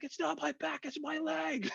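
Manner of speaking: thin, very high squeal, held back in volume; mock screaming protest. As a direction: re-enact pained screaming in falsetto, softly. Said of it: "I'm just screaming out".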